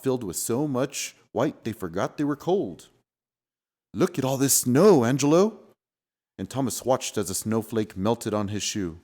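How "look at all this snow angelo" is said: delighted. Acted. Delighted at snow.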